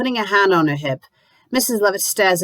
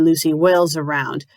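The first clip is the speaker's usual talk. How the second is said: ordinary delivery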